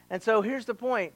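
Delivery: usual speaking voice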